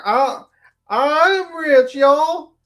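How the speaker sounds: high, drawn-out mock Southern accent, wailed loudly. Co-host auditioning to read the trial transcript, crazy Southern accent. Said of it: "two hours of my shitty Southern accent".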